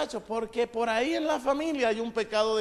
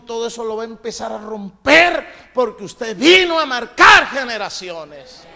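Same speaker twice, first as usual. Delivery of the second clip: much louder preaching shout; rallying shout; 13 dB louder than usual. Real, not acted. Preacher urging the church to break curses, loud shout. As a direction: drive it home to the church at full force.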